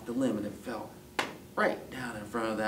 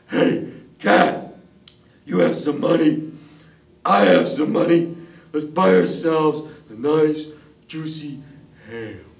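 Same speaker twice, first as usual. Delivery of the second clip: loud, raised dog character voice; eager dog; 5 semitones higher, 15 dB louder than usual. Acted. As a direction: call out heartily as the dog. Dog character proposes buying ham.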